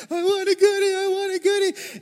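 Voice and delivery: very high, loud mimicked toddler tantrum; crying toddler. Preacher imitating a three-year-old crying for a goodie, high wail. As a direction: imitate a wailing little kid at full pitch. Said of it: "starts freaking out. She's crying".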